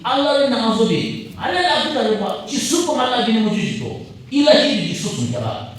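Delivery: usual speaking voice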